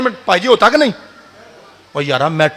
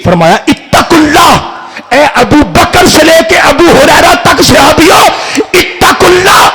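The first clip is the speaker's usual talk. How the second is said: loud, higher climactic sermon shouting; preaching at full force; 6 semitones higher, 7 dB louder than usual. Real, not acted. Preacher leading congregation to shout Haidari, full-force preaching. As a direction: build the hall to a roaring chant.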